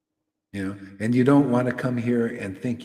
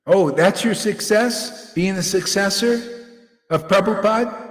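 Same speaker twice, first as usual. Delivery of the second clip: mocking sneer; 8 semitones higher, 7 dB louder than usual. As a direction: jeer at the claim, stretching "Being a successor of Prabhupada". Mocking a rival's claim.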